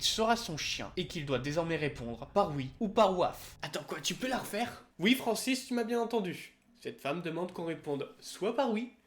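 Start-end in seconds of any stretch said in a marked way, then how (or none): 5.0-6.6 smug retort. Pretend. Mock dialogue with imaginary Francis.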